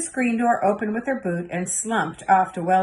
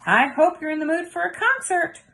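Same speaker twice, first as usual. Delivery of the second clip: put-on higher, bright eager child's voice; a little girl bursting to play for grandma; 9 semitones higher than usual. A narrator's reading. Girl greeting her grandma, eager and high.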